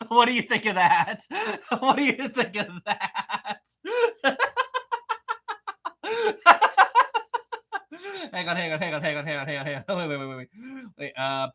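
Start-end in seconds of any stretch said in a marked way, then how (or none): 0.1-3.6 words breaking into real laughter; can't get the question out for laughing; laughing through it. Real, not acted. Streamer asks chat about dethroning Maritime, laughing. Laughing at his own proposal. 3.8-8.3 real helpless high-pitched laughing fit; long high laugh. Real, not acted. Streamer cracks up at his own joke, helpless high laugh.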